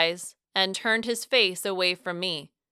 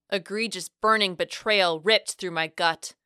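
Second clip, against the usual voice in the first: ordinary delivery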